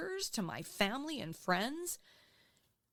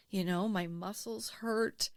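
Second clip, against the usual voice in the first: ordinary talk, nothing marked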